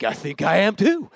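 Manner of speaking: high, rising, eager reply; excited agreement. Retold or imitated. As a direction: blurt it out in delighted amazement. Retold delighted answer to her.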